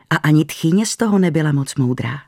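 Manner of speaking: plain reading voice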